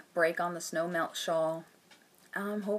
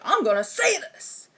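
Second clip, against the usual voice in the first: imitating actor; 9 semitones higher than usual. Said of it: "he's just trying to be like".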